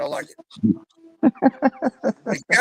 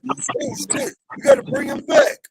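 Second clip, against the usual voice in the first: higher, louder mock panic as Ted; louder mock alarm, imitating the admin Ted; 5 dB louder than usual. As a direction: ham up Ted caving in. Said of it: "And Ted's like".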